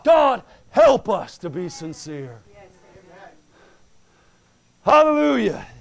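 loud, slow, fervent shout to God; shouted prayer. Real, not acted. Preacher calls out to God for the church, shouting. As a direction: cry out to God.

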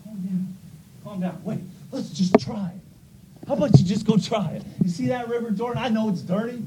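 coaxing servant, talking him down; lightly voiced pleading servant; calming servant. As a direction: soothe an angry master. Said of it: "one of his servants came over and said".

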